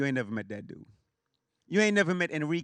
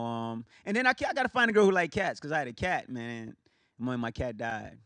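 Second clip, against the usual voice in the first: ordinary delivery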